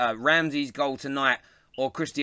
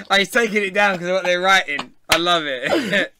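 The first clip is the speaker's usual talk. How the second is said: laughing; 3 semitones higher, 6 dB louder than usual. Real, not acted. Delighted at comments being removed.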